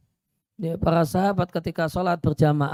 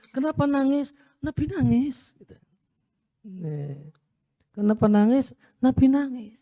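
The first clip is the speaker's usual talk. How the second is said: companions' reply; 5 semitones higher than usual. Retold or imitated. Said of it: "baru Nabi cerita kenapa menangis".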